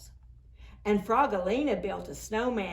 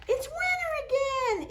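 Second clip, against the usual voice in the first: high, excited storybook shout; much faster than usual. Acted. Izzy greeting winter, high excited shout. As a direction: cry it out in delight. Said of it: "Izzy shouts".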